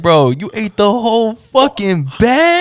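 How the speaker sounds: high, slow, drawn-out disbelief; mock incredulity. Storyteller confronting the edibles eater, mock incredulous. Retold shocked reaction to edibles.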